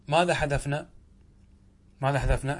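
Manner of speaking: ordinary delivery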